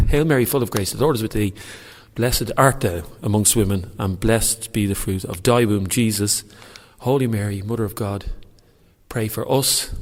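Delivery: loud, earnest Hail Mary at last; reciting a prayer aloud. Shown as a demonstration. Praying to Count Dracula in a church, loud prayer recital. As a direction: push through the whole prayer in one go. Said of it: "this is for you, Count Dracula".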